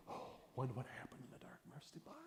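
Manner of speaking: hushed wonder. Retold or imitated. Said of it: "they're going".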